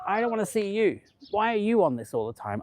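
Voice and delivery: a high, mocking take on a complaining older man; mock grumpy viewer. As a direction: lightly parody the moaning viewer. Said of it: "the same people who say".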